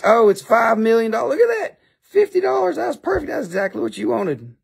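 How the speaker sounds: high-pitched excited hype; mock amazement. Seller thrilled for his bidder, high mock amazement easing off. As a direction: squeal over the price, then settle down.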